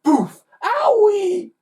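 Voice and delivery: high, loud pained yelp; a comic ouch; thump and yelp. A narrator's reading. Toy whacks the twins.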